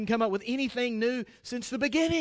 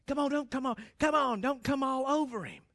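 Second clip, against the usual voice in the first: dropped to a softer voice, pleading; quieter plea; 7 dB quieter than usual. Real, not acted. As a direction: ease off, ask them gently. Gently advising wives.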